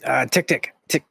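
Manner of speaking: high, stammering little dragon voice; a nervous small dragon stammering hello. Acted. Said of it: "I won't keep using the dragon voice".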